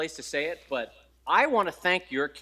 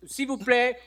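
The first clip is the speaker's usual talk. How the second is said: nervous tourist French; 6 semitones higher than usual. Retold or imitated. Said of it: "I work up the nerve".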